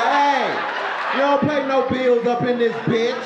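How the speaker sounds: yelling at roach. Retold or imitated. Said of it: "I was like".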